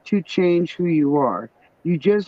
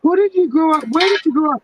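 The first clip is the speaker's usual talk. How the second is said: much higher, wide-eyed question; incredulous; 10 semitones higher, much faster than usual. Retold or imitated. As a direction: ask in disbelief. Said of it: "retold incredulous question".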